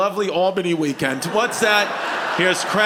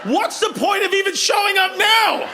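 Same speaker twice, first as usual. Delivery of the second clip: high, exasperated mock outrage; mock scolding; 10 semitones higher than usual. Comedian asks latecomers why they came, high mock outrage. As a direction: playfully berate them with pitch shooting up.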